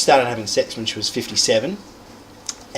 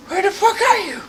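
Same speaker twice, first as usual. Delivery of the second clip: very high squeaky comic voice; high falsetto while searching. Acted.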